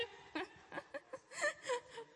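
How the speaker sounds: high laugh breaking into a mock cry; high laughing whimper. Real, not acted. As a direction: laugh until it turns into a whimper. Laughing after mock fright.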